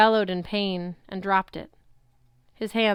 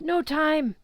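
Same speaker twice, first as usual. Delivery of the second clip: urgent pained; 8 semitones higher than usual. A narrator's reading. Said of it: "Luke groaned".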